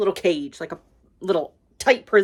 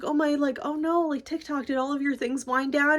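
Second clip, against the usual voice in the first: higher-pitched, soft fretful retelling; mild mock worry; 6 semitones higher, 4 dB quieter than usual. She asks Tick-Tock if he wound down, mild mock worry. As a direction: lightly imitate a worried girl, pitched up. Acts Dorothy's worried reaction.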